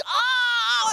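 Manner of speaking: put-on high shouted cry like a hurt toddler; child's cry. Imitating daughter hurt on jungle gym, high shouted cry. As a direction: belt out a little kid's wail. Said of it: "and she's".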